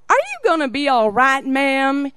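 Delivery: put-on Southern accent. Security agent to crying traveler, Southern accent. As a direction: imitate a concerned officer's Southern accent. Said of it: "with that collard green accent".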